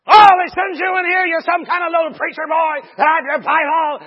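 loud, high mocking rant; acting out a screaming man's tirade; yelling, screaming. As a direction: rant at the top of your voice. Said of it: "he's yelling, screaming".

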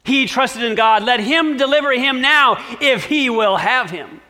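mocking. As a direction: priests jeering at the cross. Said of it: "also mocked".

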